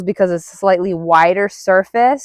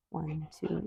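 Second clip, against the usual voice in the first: much softer, barely voiced counting; under-breath count; 3 semitones lower, 22 dB quieter than usual. Real, not acted. Trainer counting duration under her breath. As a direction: count quietly to yourself.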